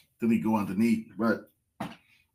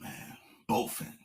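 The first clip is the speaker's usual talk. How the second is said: disappointed whisper; 9 dB quieter than usual. Real, not acted. Disappointed at wrong fish.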